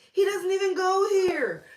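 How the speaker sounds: high, loud whispered squeal; loud breathy movie-quote squeal; Mean Girls quote. Retold or imitated. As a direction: hiss it like the Mean Girls line. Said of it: "it made me think".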